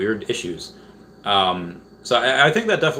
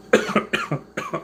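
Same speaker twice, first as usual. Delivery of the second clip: sudden hard choking cough; harsh cough; 11 semitones higher than usual. Real, not acted. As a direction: cough hard as water goes down wrong. Drink went down wrong pipe.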